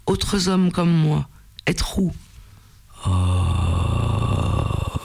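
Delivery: a lightly voiced turtle creature, halting; a hint of a cavernous beast; rocky creature voice. A narrator's reading. Turtle-man creature speaking broken French.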